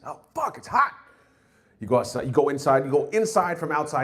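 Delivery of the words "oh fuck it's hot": high whiny complaint; mock complainer. Comic mimicking people griping about summer heat, whiny. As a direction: whine like the heat is unbearable. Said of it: "complaining about how hot it is".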